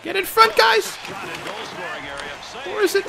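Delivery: high-pitched plea to the players; urgent high cry; squeaky urging. Real, not acted. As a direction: squeal the order at the screen. Yelling at game players.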